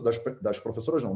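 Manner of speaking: ordinary talk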